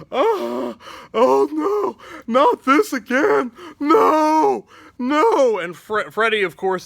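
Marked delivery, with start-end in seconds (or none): 0.1-5.6 high, loud anguished cries of no; Danny panics as his trauma returns, wailing high and loud; panicked wailing. Acted. Danny Glover reliving his trauma, wailing loud and high.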